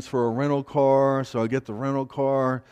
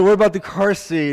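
laughing; 8 semitones higher, 8 dB louder than usual. Retold or imitated. Retelling asking officer, laughing.

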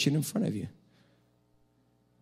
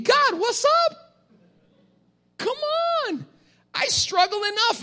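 much higher, slow whiny complaint; mock whining to God; slower than usual. Pastor to God, high whiny mock complaint. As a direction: plead comically for a break.

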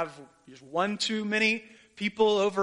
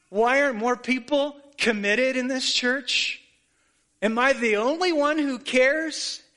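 loud, higher exasperated griping; resentful complaint; 5 semitones higher, 4 dB louder than usual. Retold or imitated. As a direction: vent like a fed-up volunteer. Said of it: "Words along the lines of".

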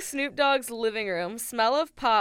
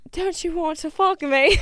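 higher, louder hammed-up British come-on; bad British accent; 7 semitones higher, 9 dB louder than usual. Playing a sultry Brit for her co-host, bad British accent. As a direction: camp British seduction, badly done. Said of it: "gonna try to be British".

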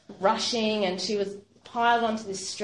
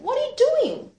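very high, loud call; 7 dB louder than usual. Retold or imitated. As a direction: shout it at someone who won't move. Kathy calls to motionless sister.

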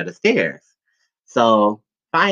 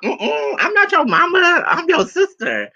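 much higher, sassy correction; sassy mom. Retold or imitated. Mom flirting with the guys, much higher and sassy. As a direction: play slick mom denying she's the mother. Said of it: "talking about".

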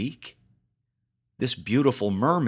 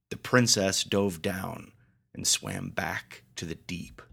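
plain reading voice

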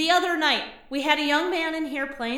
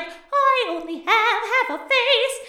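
slow, higher mock phantom voice; hammed-up phantom reveal; spooky phantom; 7 semitones higher, 4 dB louder, slower than usual. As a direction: ham up a theatrical ghost. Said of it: "and he's like".

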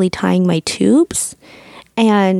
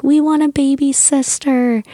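lightly imitated children, higher pitch; kids pleading; 6 semitones higher than usual. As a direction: pipe up like kids making a wish. Voicing her kids' Christmas wish.

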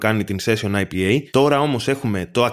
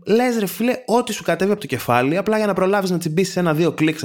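exasperated rant; 8 semitones higher than usual. Real, not acted. Ranting at clickbait article writers.

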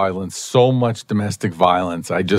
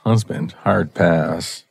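usual speaking voice

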